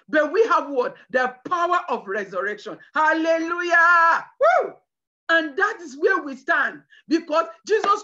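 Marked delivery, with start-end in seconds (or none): 2.9-4.3 drawn-out hallelujah. Real, not acted. Preacher exults at climax. 4.4-4.7 high joyful whoop, loud; preacher's high, loud cry of joy. Real, not acted. Shout of joy in sermon.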